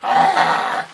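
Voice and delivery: loud groaning complaint; hammed-up despairing shout; mock despair. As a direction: wail about how bad it is. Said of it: "it's just all not like".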